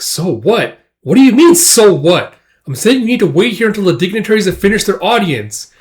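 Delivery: high, loud exasperated retort; indignant outburst. Acted. Casca argues with Guts over his so what, indignant. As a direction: throw it back at them, voice jumping up.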